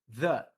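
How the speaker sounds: The as a puff over the tongue then a short e; TH breath into e; th sound. Shown as a demonstration. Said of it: "o soprinho com o ezinho depois".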